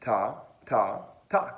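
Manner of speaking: "Ta, ta, ta" as separate syllables, tongue tapping behind teeth; tonguing demo. Shown as a demonstration. Teacher showing where the tongue goes, "Ta, ta, ta" as separate tongued syllables. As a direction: tap each "Ta" where teeth meet the roof of the mouth. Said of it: "I want you to say".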